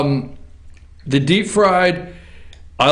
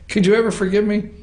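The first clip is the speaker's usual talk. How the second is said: ordinary delivery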